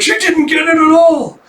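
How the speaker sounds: very high, loud mock scream; exasperated shriek. Teacher realising student understood nothing, despairing high shriek. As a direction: wail it in comic despair.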